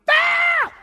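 very high, loud startling scream; imitated prank shriek, full blast; sudden scare yell. Preacher imitating Jim startling the guides, sudden loud yell. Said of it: "All of a sudden, Jim goes".

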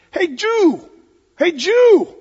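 loud, high taunting yell across the street; a street heckler bellowing a taunt; heckling shout. Retold or imitated. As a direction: call him out loudly and mockingly.

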